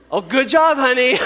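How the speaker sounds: high, loud mock-cheery coaching; loud, high-pitched praise, overdone; strained encouragement. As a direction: re-enact cheering on through panic. Coaching wife through her screaming.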